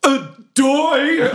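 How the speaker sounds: high, drawn-out comic holler; yell while dragged away. Acted. Spectator being dragged out of the arena, comic yell. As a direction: holler it as you are hauled off. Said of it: "I yell as I'm being dragged away".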